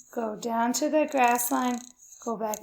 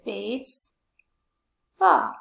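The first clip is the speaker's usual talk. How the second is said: BASEBALL very slowly, long gap between "base" and "ball"; syllable by syllable; much slower than usual. Shown as a demonstration. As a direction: break BASEBALL into two stretched syllables. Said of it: "It's two".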